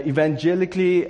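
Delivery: usual speaking voice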